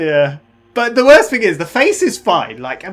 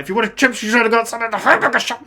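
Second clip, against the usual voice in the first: higher, quickened mock telling-off; Mac impression; 7 semitones higher, faster than usual. Mac answering a question about chips, imitated scolding. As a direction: rattle it off in a raised, scolding imitation. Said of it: "The way he says it".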